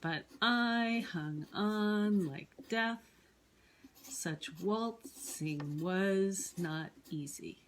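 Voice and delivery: slow, exaggerated da-DUM beat: but I hung ON like DEATH, such WALTzing WAS not EAsy; scanning the meter. Shown as a demonstration. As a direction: stretch every stressed syllable, I, ON, DEATH, WALT, WAS, EA. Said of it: "If we wanted to scan this poem".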